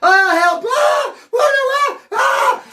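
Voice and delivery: very high, loud mock shrieks; hammed-up high-pitched terror; retold panicked screaming. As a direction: scream for help like a total coward. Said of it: "All the bitch came out of me".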